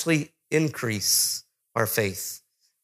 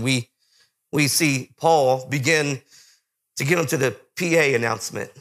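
usual speaking voice

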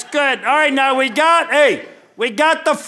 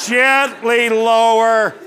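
"Gently" drawn out slowly; emphasis on Gently; much slower than usual. Real, not acted. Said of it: "I want to emphasize the word".

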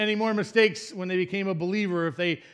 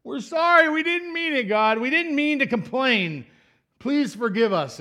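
high, loud desperate begging; pleading apology; 8 semitones higher, 4 dB louder than usual. Retold or imitated. Israelites begging God to forgive them, loud high pleading. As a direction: beg God loudly for forgiveness. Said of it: "guess what they say".

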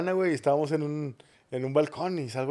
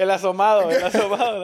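high joking voice, repeated twice; mocking voice; 5 semitones higher, 8 dB louder than usual. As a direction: tease it in a silly put-on voice. Joking about the exposed egg. (voz burlona y aguda)

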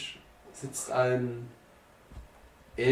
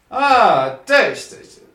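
loud, higher-pitched paternal greeting; mafia father greeting daughter; 5 semitones higher, 8 dB louder, slower than usual. Acted. Mob boss spots his daughter and calls her over, loudly. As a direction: call your daughter over with a big exclamation. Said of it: "als er Hannah sieht".

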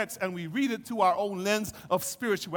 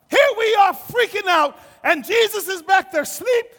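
loud, high, exasperated complaint; acting out freaked-out outrage; outraged shouting; 10 semitones higher, 10 dB louder than usual. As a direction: holler it in indignant panic. Disciples outraged at sleeping Jesus.

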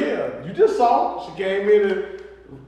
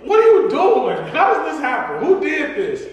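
raised-pitch, incredulous dad demanding answers; retold exasperated interrogation; 5 semitones higher, 3 dB louder than usual. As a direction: act out grilling the kids, pitched up. Acts out confronting kids over mess.

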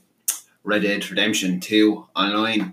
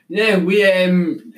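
clipping distorted mic; loud enough to clip the mic; yelling into the mic; 8 semitones higher, 7 dB louder, much slower than usual. Real, not acted. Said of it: "He's screaming".

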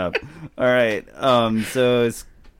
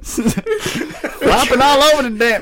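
high, slow words through laughter; can barely get it out for laughing; laughing hard; 11 semitones higher, 5 dB louder, slower than usual. Real, not acted. Mic flopping, laughing hard.

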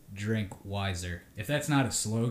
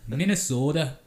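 higher, louder Minnesota vowels on Minnesota; Minnesota accent; 5 semitones higher, 6 dB louder than usual. Shown as a demonstration. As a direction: stretch Minnesota the Midwestern way. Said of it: "Can you do that again for me?".

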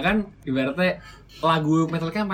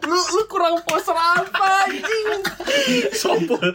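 much higher, laughing squeal of disbelief; laughing mock scolding; 13 semitones higher, slower than usual. Real, not acted. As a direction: tease him through giggles. Teasing friend's poser slip.

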